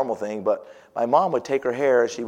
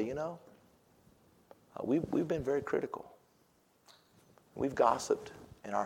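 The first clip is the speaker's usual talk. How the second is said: much softer, lightly voiced family prayer; hushed confession; 3 semitones lower, 10 dB quieter than usual. Shown as a demonstration. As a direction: confess quietly as a humbled parent. Model confession for the congregation.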